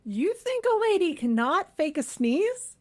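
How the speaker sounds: high, drawn-out diva pig voice; Miss Piggy. Retold or imitated. Podcaster acting Miss Piggy's parody line for co-host, high pig-diva impression. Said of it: "with her Miss Piggy voice".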